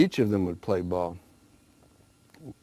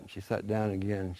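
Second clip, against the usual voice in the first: ordinary delivery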